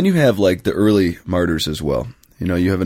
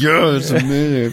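forced politeness; 7 semitones higher than usual. Retold or imitated. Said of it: "And I was like".

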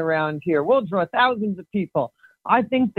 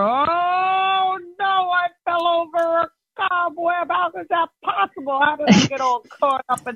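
hammed-up dismay; 7 semitones higher than usual. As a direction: wail in pitched-up disbelief after a silly fall. Farmer falls over a cobweb.

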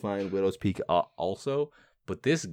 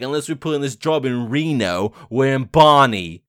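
mock British accent, louder and drawn out at the end; Basher; 4 semitones higher, 8 dB louder than usual. Quoting a baffling movie line, exaggerated British accent. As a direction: lean hard on the last words in a fake British accent. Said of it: "he says some fucking line like".

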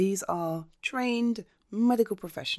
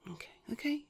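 hushed soothing whisper; 9 semitones higher, 10 dB quieter, faster than usual. Real, not acted. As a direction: whisper to calm them. Calming a nervous friend.

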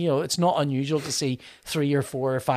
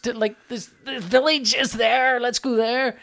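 high-pitched, astonished retold outburst; excited realization; 8 semitones higher than usual. Spotting the village they completely missed, excited realization. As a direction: re-enact spotting it with rising excitement.

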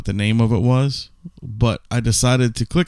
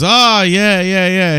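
higher, louder drawn-out yeahs; mock eager agreement; 5 semitones higher, 4 dB louder, slower than usual. German actor answering Brando's garbled German, mock eager agreement. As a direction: imitate an actor playing along eagerly.

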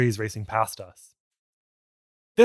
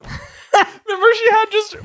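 laughing squeal; 5 dB louder, slower than usual. Real, not acted.

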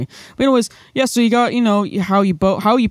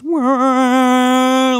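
higher, fuller wah sound; swelling wah; 9 semitones higher, 3 dB louder than usual. Shown as a demonstration. Showing the instrument with the mod wheel up, swelling wah. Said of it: "you move up the mod wheel, and it goes".

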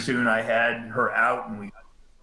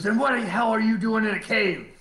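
high-pitched indignant scolding, acted out; retold angry demand, pitched up; reaming him out; 8 semitones higher than usual. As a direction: imitate an outraged dressing-down. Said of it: "he started just reaming that".

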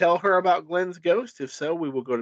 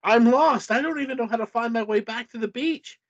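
higher, rushed pleading; acted helpless outburst; lost and desperate; 8 semitones higher than usual. A narrator's reading. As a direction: blurt it out in mild panic. Lost character pleads with ghost.

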